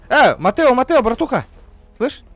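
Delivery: громко зовёт друга; окликает, повысив голос; calling out. Real, not acted. Calling out to friend. (громкий оклик)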